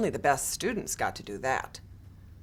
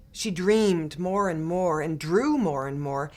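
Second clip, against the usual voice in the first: plain reading voice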